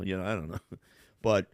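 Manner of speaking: usual speaking voice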